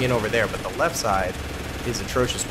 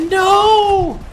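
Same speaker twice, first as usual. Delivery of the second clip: very high, loud drawn-out no; high-pitched yell of defeat; dismayed cry; 6 dB louder than usual. Real, not acted. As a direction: wail it as it all goes wrong. Fails while peeking at enemies.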